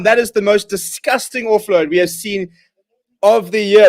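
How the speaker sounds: ordinary talk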